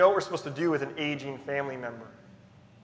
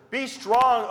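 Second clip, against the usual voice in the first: loud, high command; exhortation; 7 semitones higher, 7 dB louder than usual. Acted. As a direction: call it out like a herald. Repeated exhortation from scripture.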